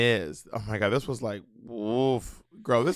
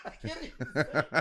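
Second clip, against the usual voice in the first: whispered through laughing; can barely speak from laughing; breathless laughter; 12 dB quieter, much faster than usual. Real, not acted. Cracking up while trying to quote Wendy, breathless.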